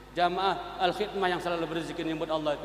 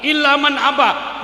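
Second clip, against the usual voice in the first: raised, slow hadith recitation; loud recitation; 8 semitones higher, 9 dB louder, slower than usual. Acted. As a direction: declaim the Arabic slowly and loudly. (lantunan hadis keras dan lambat)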